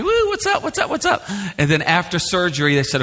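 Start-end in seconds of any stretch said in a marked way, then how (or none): 0.0-1.2 high, giddy party hollering; happy and giddy. Acted. Dad acting happy patient before surgery, mock giddy whooping. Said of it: "you're happy".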